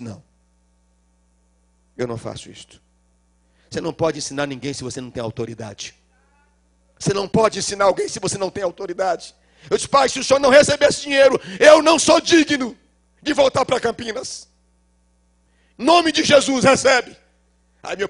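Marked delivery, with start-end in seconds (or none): 0.0-2.8 low, soft embarrassed no; sheepish admission. Retold or imitated. Brother quietly admitting he doesn't do it. 9.9-17.2 fast, high, loud insistence; loud pleading. Retold or imitated. Son loudly begging his father to accept.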